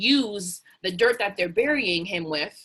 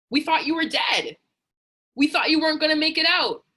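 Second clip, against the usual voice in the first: much higher, louder astonished shout; amazed shout; 8 semitones higher, 6 dB louder than usual. Acted. People amazed someone came back from nothing, astonished shout. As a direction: call out in disbelief at the comeback.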